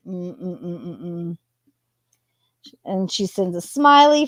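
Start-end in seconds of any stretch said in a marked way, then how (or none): 0.1-1.4 low, soft humming "mm-mm-mm" of disgust; disapproving hum. Real, not acted. Reading the couple's texts, a soft low disapproving hum. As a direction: hum your disapproval under your breath. Reacting to creepy text.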